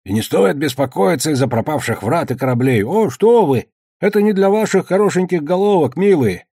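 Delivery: lightly hammed-up parody of a patronising old man; hoarse mocking mimicry. A narrator's reading. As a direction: mimic the old official, climbing into high mock concern. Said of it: "Каспар хрипло, передразнивая старика, забормотал".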